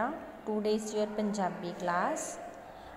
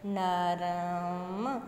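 sounding out slowly; 3 semitones lower, much slower than usual. Shown as a demonstration. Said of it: "ਤਿੰਨੋਂ ਤਿੰਨੋਂ sounds ਆ ਰਹੀਆਂ ਨੇ".

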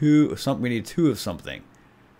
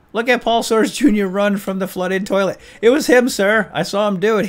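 gleeful tattling; 11 semitones higher, 9 dB louder than usual. Acted.

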